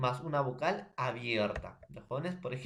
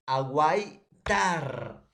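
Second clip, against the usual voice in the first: very slow syllable split; 3 semitones higher, 8 dB louder, much slower than usual. Shown as a demonstration. Student syllabifies word.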